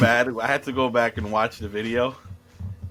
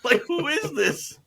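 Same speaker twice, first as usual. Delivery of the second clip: high, laughing disbelief; real laughter; 11 semitones higher, slower than usual. Real, not acted. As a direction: squeak it out through laughter. Laughing over the garbled name.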